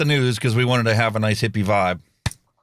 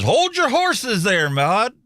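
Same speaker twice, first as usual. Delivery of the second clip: Southern drawl; 10 semitones higher, slower than usual. Retold or imitated. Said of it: "H Y H is".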